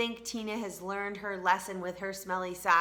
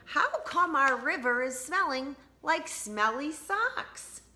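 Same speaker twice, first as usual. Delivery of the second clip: higher, slow puzzled villagers asking; a hint of perplexed neighbors, slowed down; puzzled townsfolk; 7 semitones higher than usual. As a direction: wonder aloud like baffled townsfolk. Townspeople downriver complain.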